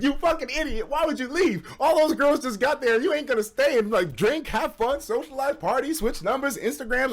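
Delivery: playfully voicing the chat; chat scolding. Viewers scolding him for leaving, mild mock scolding. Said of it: "some of y'all in the chat are gonna be like".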